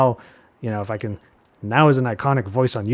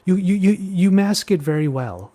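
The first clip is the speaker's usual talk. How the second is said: mimicking the client; 7 semitones higher, 4 dB louder than usual. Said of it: "And she just goes".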